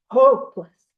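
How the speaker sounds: Hopeless hit hard and loud, falling; stress on hopeless. Shown as a demonstration. Teaching the group, Hopeless said hard and loud. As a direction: punch out the word Hopeless. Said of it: "we want to emphasize that word hopeless".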